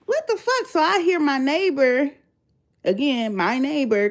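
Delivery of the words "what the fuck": high squeaky what the fuck; stunned disbelief; shocked. Retold or imitated. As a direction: pitch shoots way up in disbelief. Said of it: "I'm like".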